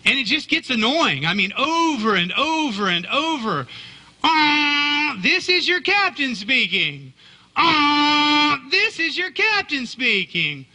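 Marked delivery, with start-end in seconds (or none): 4.2-10.6 mock ship captain announcement. Preacher mocking the cruise robocall captain for the congregation, hammed-up announcer voice.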